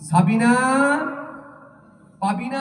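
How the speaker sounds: high loud call into the dark; calling out names. Retold or imitated. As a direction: call the girls from afar. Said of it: "ডাকতে শুরু করেছে". (জোরে ডাকা)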